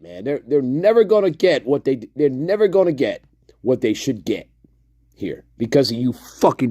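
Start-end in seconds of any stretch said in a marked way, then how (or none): none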